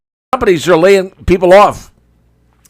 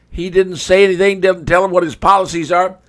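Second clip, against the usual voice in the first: ordinary delivery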